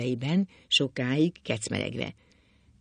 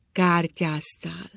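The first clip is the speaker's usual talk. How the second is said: slow, drawn-out, higher question; grave reproach; 4 semitones higher, much slower than usual. A narrator's reading. Wife asking drunk husband about gambling, slow and reproachful. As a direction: stretch the word out in disapproval.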